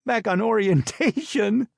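high, slow mock disbelief; mocking squeaky outrage; mock shocked boy. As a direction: ham up the new boy's shock. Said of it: "He's looking at Brother Ray like".

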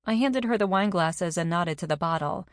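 ordinary delivery

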